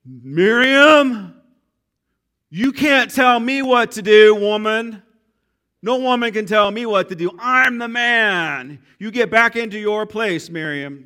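comic overbearing Moses, loud and high; mock macho scolding. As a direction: bark it at her like a pompous man putting a woman in her place. Preacher acts out Moses scolding Miriam.

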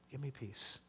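almost whisper the plea again. Retold or imitated. Pastor voicing David at bedtime, very softly pleading. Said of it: "And he says".

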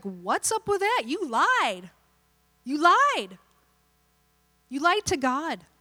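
acted confrontation, pitch jumping up; mock-indignant apostle; accusing. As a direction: call them out, punching each accusation. Acts Peter confronting the couple.

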